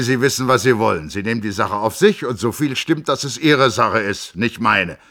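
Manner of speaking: a blustering physician giving his blessing; gruff doctor. A narrator's reading. The Hofrat sends Joachim off, hearty and loud.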